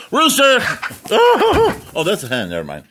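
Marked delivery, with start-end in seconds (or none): 0.1-0.6 much higher, louder shout of rooster; calling out. Real, not acted. 0.6-1.9 high shrieking laugh. Real, not acted. Laughing after shouting at rooster.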